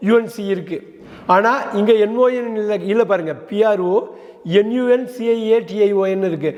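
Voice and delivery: ordinary talk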